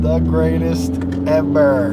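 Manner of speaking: deep, slow trailer-voice tribute; deep slow announcer. Pretend. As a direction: drop it deep like a movie trailer. Repeat said in deep voice.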